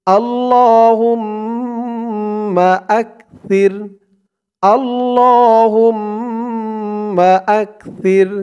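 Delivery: slow chanted Arabic recitation. Shown as a demonstration. Teacher recites the dua phrase for learners, slow chant. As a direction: intone the phrase slowly and melodically.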